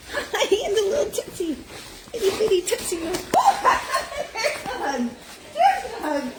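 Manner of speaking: high voice through real laughter; laughing high greeting; laughing delight. Real, not acted. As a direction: giggle while greeting the pup. Dogs giving hugs, laughing.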